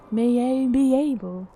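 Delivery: "May A be able" with the stray A sounded out; extra A sound. Shown as a demonstration. Streamer mocking own misreading, "May A be able" with an extra A. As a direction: replay the slip "May A be able", drawing attention to the A. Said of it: "Too many A's going on".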